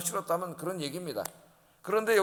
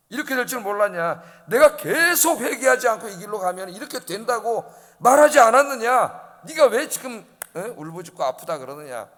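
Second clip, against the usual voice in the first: God rebuking; 4 semitones higher, 7 dB louder than usual. Acted. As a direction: scold the people for not listening. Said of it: "하나님 뭐라 그러시는 거예요?". (크게 꾸짖는 하나님의 목소리)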